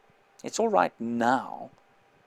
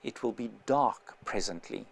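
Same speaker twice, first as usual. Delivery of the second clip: barely voiced, much softer; mouthed; 4 semitones lower, 9 dB quieter than usual. Mouthing a secret warning to the narrator, near silent. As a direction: shape the words almost silently. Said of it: "he mouthed".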